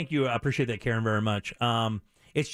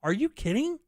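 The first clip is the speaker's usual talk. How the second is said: much higher mock wife, exasperated; scolding wife; 7 semitones higher than usual. Husband retells wife scolding his swearing, higher mock voice. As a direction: play the wife's disbelief, pitched up. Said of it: "She goes".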